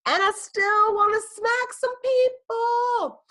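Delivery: high, slow, squealing punchline. Real, not acted. Reading the funny mug slogan.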